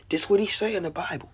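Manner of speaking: normal talking voice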